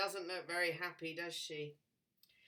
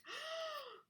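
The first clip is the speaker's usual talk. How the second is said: gasp. Acted. Throwing the frog.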